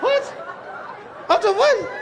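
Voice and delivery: very high-pitched incredulous squeal; comic high-pitched double take; shocked disbelief. Retold or imitated. Husband shocked by overheard phone call.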